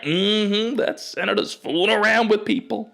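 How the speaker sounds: high, loud gossiping crowd voice; audience heckler. Retold or imitated. Crowd insisting the senator cheats, high and loud. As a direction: tattle on him loudly and high. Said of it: "They're like".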